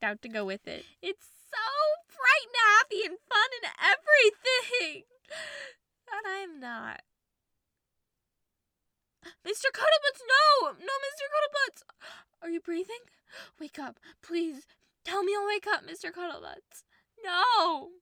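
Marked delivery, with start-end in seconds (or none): 1.0-5.0 high, loud, crying breakdown; crazed with grief. Acted. 6.1-7.0 crazed with grief. Acted. Crying after breakdown. 9.4-17.9 high, loud, panicked wailing; crazed with grief. Acted. Panicked over fallen stuffed dog.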